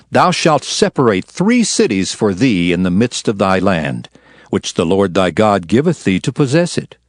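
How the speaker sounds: ordinary talk, nothing marked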